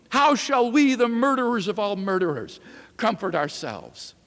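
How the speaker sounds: high, loud cry that sinks at the end; anguished outcry. Acted. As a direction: plead with the crowd, then fade. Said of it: "The madman turned in their midst".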